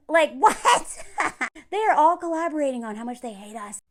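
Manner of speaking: usual speaking voice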